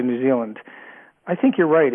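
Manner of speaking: ordinary delivery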